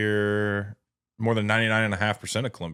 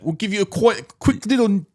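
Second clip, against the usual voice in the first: high, louder playful Aussie threat; mock Australian accent; 11 semitones higher, 5 dB louder than usual. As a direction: tease him like a cheeky Aussie thug. Continuing Aussie tough guy bit.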